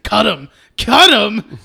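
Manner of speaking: loud, drawn-out taunting urge; mock bully egging-on. Bully urging Henry on with the knife, hammed-up. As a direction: goad him slowly and loudly.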